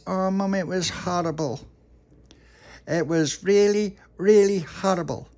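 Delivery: put-on high, slow whiny child voice; upset little puppy. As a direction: whimper it out to mum. Puppy upset telling mum.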